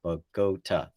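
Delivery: "Bogota" in three evenly separated beats; syllable by syllable. Shown as a demonstration. Teacher counting the syllables for listeners, "Bogota" said beat by beat. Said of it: "three syllables".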